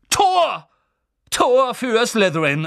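loud, high shout of goal; goal call. Acted. Lee announcing a Slytherin goal, shouting. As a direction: belt it out across the stadium.

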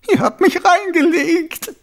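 very high, loud crying wail; cartoon crybaby. A narrator's reading. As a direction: howl it through tears, betrayed. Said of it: "heulte Frettchen".

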